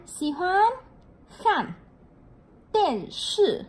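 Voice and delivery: Xì hoan khan điện sư said with the Mandarin tones; Chinese word modeled. Shown as a demonstration. Teacher models a Chinese phrase for students, careful tones. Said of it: "teacher models diànshì".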